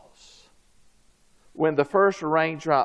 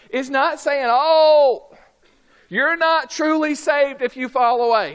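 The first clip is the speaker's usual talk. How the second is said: forceful preaching; 8 semitones higher, 4 dB louder than usual. Acted. Preacher urges the church to stay awake and pray, forceful. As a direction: drive it home to the congregation.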